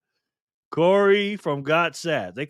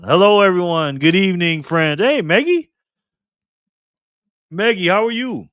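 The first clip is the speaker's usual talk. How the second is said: excited greeting; 5 semitones higher, 8 dB louder than usual. Real, not acted. Streamer greets chat members by name.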